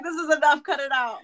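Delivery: mock protest. Retelling own protest at being attacked.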